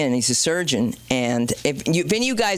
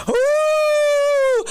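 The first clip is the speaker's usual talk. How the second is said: very high, loud imitated howl; the dogs in the audience howling loud and high; coyote howl; 5 dB louder than usual. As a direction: howl like a dog hit by a mic squeal. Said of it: "Like coyotes".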